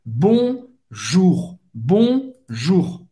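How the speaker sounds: "bonjour" com R arranhado na garganta; guttural R. Shown as a demonstration. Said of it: "arranhar mesmo a garganta". ("bonjour" com R gutural)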